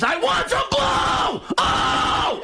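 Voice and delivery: long, high-pitched screamed outburst; Sam Kinison. Retold or imitated. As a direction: scream it till the voice tears. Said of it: "it sounds like Sam Kinison".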